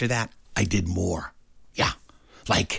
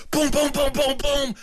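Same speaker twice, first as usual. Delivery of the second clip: loud pounding knock. Retold or imitated. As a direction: boom boom boom pounded out much higher and louder. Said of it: "You didn't knock loud enough".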